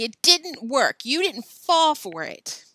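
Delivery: slow, loud, high argument; yelled. A narrator's reading. Joe tells Billy the trick failed, slow emphatic yell. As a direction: yell it slowly to make the point. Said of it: "Joe yelled at Billy".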